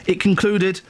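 ordinary talk

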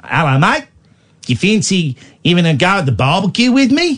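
broad comic Australian accent. Shown as a demonstration. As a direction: lay on a matey Aussie twang.

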